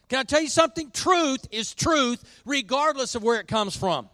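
high, emphatic pulpit delivery; impassioned preaching. Real, not acted. Pastor telling church truth holds whoever preaches it, impassioned. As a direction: drive each point home, stretching key words. Preacher returns to full force.

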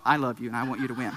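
low, quick aside of affection; imitated kid abruptly tender; sudden softening. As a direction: drop the temper and concede sweetly. Boy abruptly softens to baby sister.

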